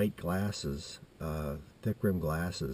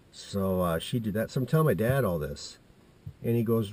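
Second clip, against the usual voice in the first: usual speaking voice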